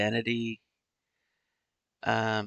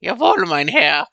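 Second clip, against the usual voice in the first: loud, high hammed-up German reply; playful German-accented jawohl mein Herr; mock German salute; 9 semitones higher, 9 dB louder than usual. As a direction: bark it like a mock soldier.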